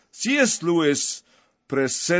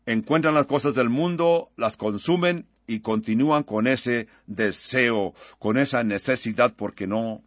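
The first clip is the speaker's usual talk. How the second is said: prayer; 4 semitones lower than usual. Real, not acted. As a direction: pray over the listeners, unhurried and sincere. Leads congregation in prayer.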